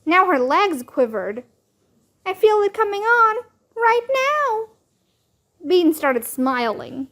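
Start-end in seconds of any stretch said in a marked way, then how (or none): 2.2-4.7 put-on high squeaky little girl; squeaky, as if something is coming over you. A narrator's reading. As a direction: squeak it out, pitched way up. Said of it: "Ivy said in a squeaky voice".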